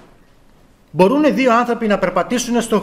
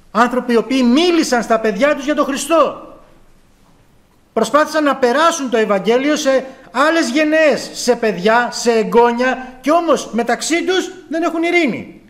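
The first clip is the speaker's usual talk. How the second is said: impassioned preaching; 6 semitones higher than usual. Acted. Preacher rebuking old believers who quarrel, impassioned. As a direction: plead with the congregation over quarrelling elders.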